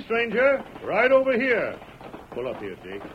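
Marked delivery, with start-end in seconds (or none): 0.1-1.8 high, loud hail to a rider; shouting back. Acted. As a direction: holler it across the field.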